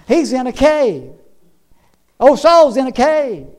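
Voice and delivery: impassioned pulpit cadence; forceful preaching. Real, not acted. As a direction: preach it out in punchy, rising phrases.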